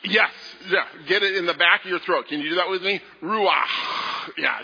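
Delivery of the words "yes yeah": usual speaking voice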